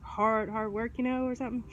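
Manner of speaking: ordinary talk, nothing marked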